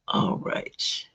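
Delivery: breathy whispered aside to herself. Real, not acted. Whispering to herself while shuffling the tarot deck.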